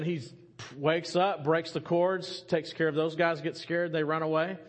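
usual speaking voice

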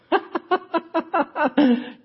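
a high scornful laugh; mocking giant laugh. Goliath laughing at the boy David, scornful. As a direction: laugh down at the little shepherd.

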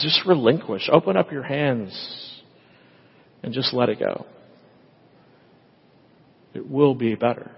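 gentle voice of God. Retold or imitated. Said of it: "And God says".

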